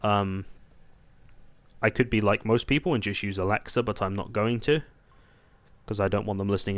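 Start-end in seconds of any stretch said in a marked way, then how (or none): none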